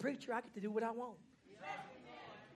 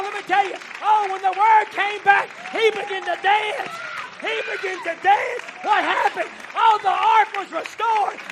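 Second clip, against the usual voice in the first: preaching at full force; 9 semitones higher, 16 dB louder than usual. Acted. Preacher shouting freedom to his congregation, full-force preaching. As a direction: lift the church with a rising shout.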